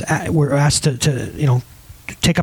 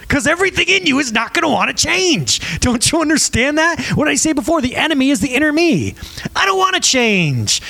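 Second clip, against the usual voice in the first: pitched up, whiny inner-self voice; mock your own reluctant self, higher; 9 semitones higher than usual. Pastor mimics the stubborn inner self, higher whiny voice.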